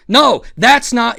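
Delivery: high, loud, drawn-out protest; Boogie. Retold or imitated. Boogie correcting the storyteller about a name, imitated loud protest. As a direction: impersonate him correcting, loudly and slowly. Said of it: "Boogie was like".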